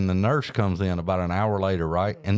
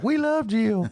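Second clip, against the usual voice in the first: mock fan voice, much higher; adoring fans; slower than usual. Imitating fans who adore Jill, gushing high. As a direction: gush like a crowd of fans. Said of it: "They're like".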